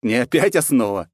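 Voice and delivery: lightly smug correction; amused, teasing retort; smirking quip. A narrator's reading. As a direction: toss it back with a smirk. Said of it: "хмыкнул я".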